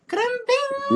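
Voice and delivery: high, squeaky put-on voice; squeaky mock voice. Asking a friend for a British accent, squeaky and high. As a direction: squeak it out like a cartoon.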